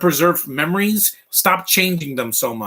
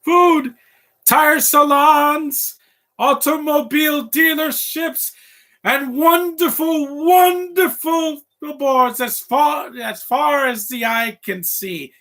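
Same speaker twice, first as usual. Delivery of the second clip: much higher, slow, grandiose villain monologue; villain's grand speech; 11 semitones higher, 4 dB louder, slower than usual. Retold or imitated. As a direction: sell the dream like a scheming visionary. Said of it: "This speech is fantastic".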